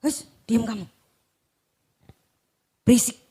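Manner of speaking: shushing rebuke. Acted. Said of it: "seperti kita nih loh".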